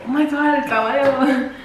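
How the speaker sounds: playful mock-scared gasp; mock teasing. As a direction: tease her with a fake fright. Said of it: "try to make fun of her".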